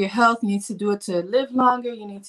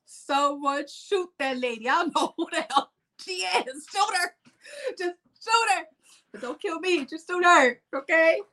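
high, hammed-up panic at the mirror; comic mock meltdown; 4 semitones higher than usual. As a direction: beg someone to shoot the lady in the mirror. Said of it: "I'm like".

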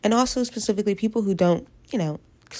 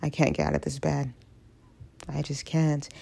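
much softer, worn out; defeated quiet; 3 semitones lower, 7 dB quieter than usual. Retold or imitated. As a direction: mumble it from bed. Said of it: "I was like".

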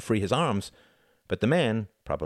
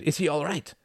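alarmed, higher-pitched question; shouting; 9 semitones higher than usual. A narrator's reading. Klaus running over to the injured Reginald, alarmed shout. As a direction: ask urgently, pitched up. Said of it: "he shouted".

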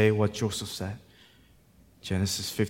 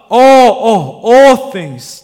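each "all" shouted high and loud; loud, high preacher's stress on each "all"; emphatic repeated ALL; 14 semitones higher, 19 dB louder, much slower than usual. Real, not acted. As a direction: hammer every "all" as its own beat. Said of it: "I cannot emphasize that word enough".

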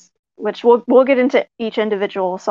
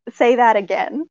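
normal talking voice

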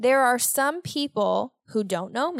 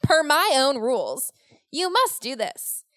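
mock bossy rule-maker; 3 semitones higher than usual. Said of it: "I love how I said the rules".

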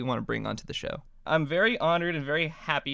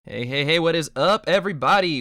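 loud, hyped-up welcome to listeners; big punchy host greeting, stretched and loud; show-opener greeting; 3 semitones higher, 8 dB louder than usual. Real, not acted. Host opening a podcast episode for listeners, hyped-up greeting.

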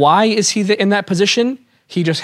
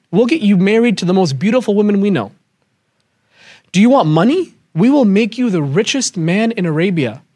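heated offers; 3 semitones higher than usual. Retold or imitated. Walid pressing the Prophet with offers, heated. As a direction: bargain hard, voice pushed up. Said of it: "he gets a tantrum".

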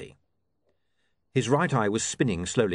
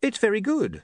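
higher, hasty praise; hurried reassurance; 13 semitones higher, 3 dB louder than usual. A narrator's reading. Editor reassuring blushing colleague, hurriedly. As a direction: jump in to reassure her. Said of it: "said William hurriedly".

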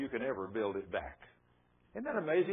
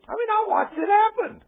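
high, loud disbelief; amazed exclaim; 10 dB louder than usual. Real, not acted. Preacher to the congregation about the granddaughter, loud amazed exclaim. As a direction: burst out in disbelief.

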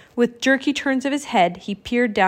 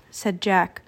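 ordinary delivery, nothing marked

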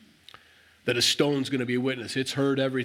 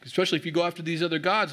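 higher-pitched, skeptical prodding; challenging; 5 semitones higher than usual. Retold or imitated. Joshua testing the people's promise, challenging. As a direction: lean in and give them a hard time. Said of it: "he's kinda giving them a hard time".

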